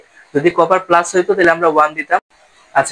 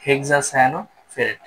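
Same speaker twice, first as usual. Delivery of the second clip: slow careful naming; 4 dB quieter, much slower than usual. Shown as a demonstration. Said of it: "তাহলে".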